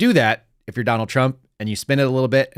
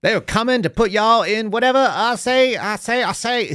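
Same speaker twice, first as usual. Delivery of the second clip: Foghorn Leghorn; 10 semitones higher than usual. Retold or imitated. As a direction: ham up a Southern cartoon rooster. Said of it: "She, she should do Foghorn Leghorn".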